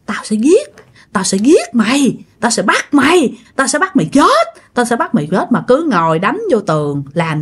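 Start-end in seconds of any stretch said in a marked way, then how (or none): 0.1-5.5 repeated acted threat, starting near a whisper; imitated possessed menace; retold death threat. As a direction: chant the menace, pitch lifting as it repeats. Possessed man muttering death threats at wall.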